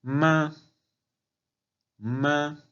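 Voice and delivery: "ma" high, level and sustained; first tone. Shown as a demonstration. Teacher repeating the tones, "ma" high and level. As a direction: hold "ma" on a high steady note. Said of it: "primer tono".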